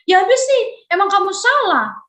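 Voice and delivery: hammed-up blaming outburst; mock quarrel. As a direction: pick a fight with a friend. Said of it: "Berantem lah ya".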